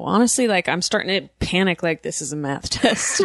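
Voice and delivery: usual speaking voice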